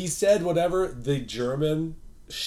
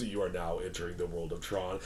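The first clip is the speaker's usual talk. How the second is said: low, soft announcement voice; ride announcer; 8 semitones lower, 10 dB quieter than usual. Retold or imitated. Narrator announces the Tron tunnel to riders, low intoning.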